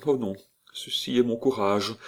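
usual speaking voice

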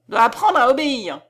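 loud, commanding exclamation; crying out. A narrator's reading. Count ordering his wife to obey, crying out. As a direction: lay down the law loudly. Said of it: "s'écria-t-il".

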